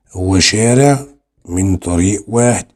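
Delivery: ordinary talk, nothing marked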